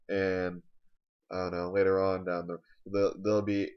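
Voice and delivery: ordinary talk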